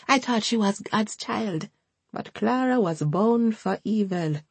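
put-on high, mournful elderly woman's voice; bitter, pious old woman; old Jamaican woman. A narrator's reading. Hortense voiced.

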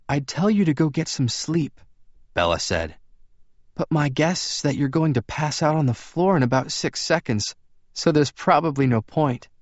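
put-on high-pitched young woman's dialogue; Bella's character voice. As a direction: lift the voice well up for her.

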